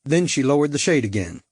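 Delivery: usual speaking voice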